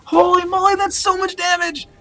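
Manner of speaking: excited exclamation. Real, not acted. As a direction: gasp at the huge damage. Caster reacts to burst damage.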